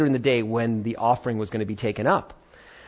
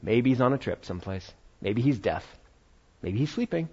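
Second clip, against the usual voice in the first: dry quiet mockery; 4 semitones lower, 8 dB quieter than usual. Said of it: "And Elijah's just like".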